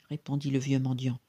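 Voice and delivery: ordinary delivery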